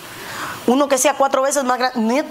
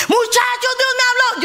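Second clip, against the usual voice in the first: high, eager cry to the crew; leaping up to tell everyone God spoke; excited announcement; 8 semitones higher than usual. Retold or imitated. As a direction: burst out with the news like a madwoman. Jumping up shouting to workers.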